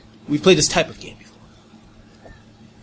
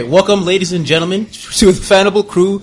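announcer welcome; 5 semitones higher, 4 dB louder than usual. Acted. Show opening welcome.

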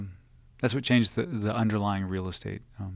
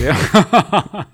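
laughing; 6 semitones higher, 11 dB louder than usual. Real, not acted. Laughing agreement.

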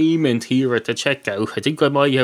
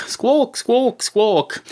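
bird squawk; 9 semitones higher, much slower than usual. Pretend. Cornflakes box making bird noise.

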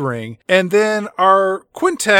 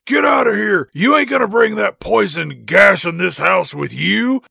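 mock shout; 4 semitones higher than usual. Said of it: "The guy inside the house says".

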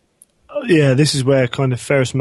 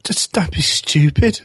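dismissive; 4 semitones higher, slower than usual. Retold or imitated. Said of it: "Ferrus is just like".

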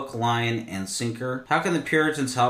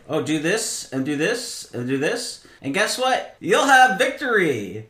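hammed-up salesy promise, rising higher and louder to a big finish; mock formula preacher; 5 semitones higher, 5 dB louder than usual. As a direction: sell it like a cheap quick-fix preacher, building to victory. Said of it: "they weren't, like, formula".

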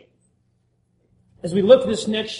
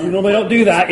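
usual speaking voice

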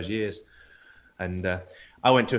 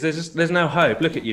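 counselor quoted with a raised, louder voice; voicing the blunt counselor, pitched up; retold counselor; 9 semitones higher, 5 dB louder than usual.

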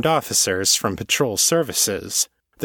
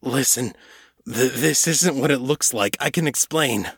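higher, stammering fear; shaky and hoarse; 3 semitones higher than usual. Acted. Cornered sergeant pleading with his captain, shaky. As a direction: plead with a frightened catch. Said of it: "his voice shaky and hoarse".

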